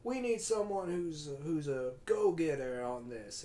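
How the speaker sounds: slow southern molasses drawl; Al Pacino, southern accent. Acted. Boss demands a go-getter, mock southern drawl. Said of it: "He's kinda doing a southern, like, molasses".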